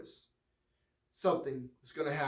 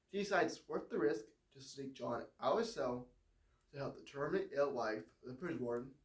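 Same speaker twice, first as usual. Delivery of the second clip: ordinary delivery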